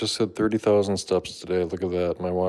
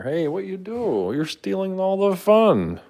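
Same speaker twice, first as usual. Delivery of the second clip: pet talk; 12 semitones higher, 3 dB louder than usual. Real, not acted. Owner teasing cat in high slow pet voice.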